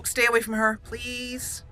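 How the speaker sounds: normal talking voice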